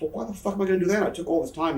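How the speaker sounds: high, fast, indignant comeback; indignant protest. Retold or imitated. Wrestler retelling his objection to pointless spots, indignant protest. Retold argument over match instructions.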